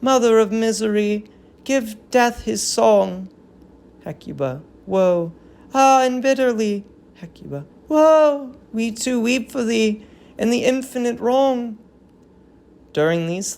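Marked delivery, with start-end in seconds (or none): 0.0-7.6 verse lament, somewhat higher; a touch of keening women; mourning chorus. Acted. Chorus and Hecuba exchange wails. 7.9-8.5 a loud, high wail of woe; loud mourning cry. Acted. Ritual wail in lament. 8.8-11.8 verse lament, somewhat higher; a touch of keening women; mourning chorus. Acted. Chorus and Hecuba exchange wails.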